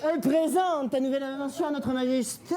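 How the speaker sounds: high, ceremonious presenter voice; high-pitched herald; eager courtier. Acted. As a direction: announce it to the king in a high put-on voice.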